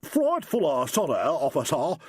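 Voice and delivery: mock a posh English toff; high mock upper-class English; posh English dude. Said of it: "in a real dude voice".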